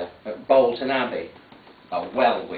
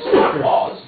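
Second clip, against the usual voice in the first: usual speaking voice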